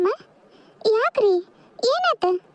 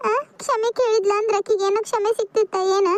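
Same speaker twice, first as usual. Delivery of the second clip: ordinary talk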